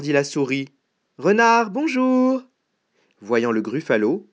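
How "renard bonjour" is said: high mouse hail; calling out greeting. Acted. Mouse hailing the fox, high call. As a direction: call hello in a high, carrying voice.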